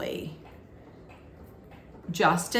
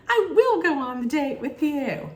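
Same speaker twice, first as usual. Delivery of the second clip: much higher, keen acceptance; mock eager yes; 9 semitones higher, 4 dB louder than usual. [name] accepting Justin's date card, eager. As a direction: jump at the offer.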